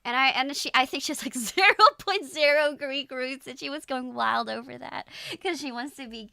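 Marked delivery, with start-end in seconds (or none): 1.3-3.5 laughing. Real, not acted. Teasing mom about Greek roots.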